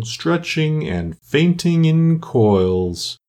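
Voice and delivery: very slow, long-drawn vowels; doleful turtle. Acted. Mock Turtle lists his lessons for Alice, slow and doleful. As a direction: stretch each subject out ponderously.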